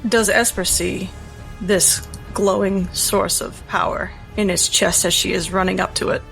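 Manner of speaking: ordinary talk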